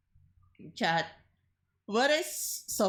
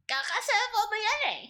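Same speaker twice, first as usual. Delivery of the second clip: Filipino mom voice; 13 semitones higher than usual. Acted. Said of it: "typical Filipino mom".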